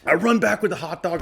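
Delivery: ordinary talk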